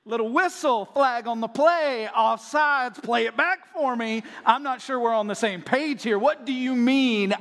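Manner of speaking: lightly hammed-up referee; playful referee call. Comic referee bit as Mary.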